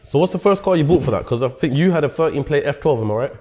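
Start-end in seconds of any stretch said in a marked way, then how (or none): none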